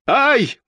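high-pitched yelp of "ai"; short high shriek, acted; startled cry. A narrator's reading. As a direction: cry out sharply in alarm. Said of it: "закричал человек с бледным лицом".

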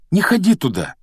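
high, loud warning; pleading. Acted. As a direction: implore the friend not to. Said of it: "взмолился Рон".

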